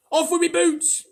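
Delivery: very high, loud frantic centipede; shouted. A narrator's reading. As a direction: yell for the boots in panic. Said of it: "shouted the centipede".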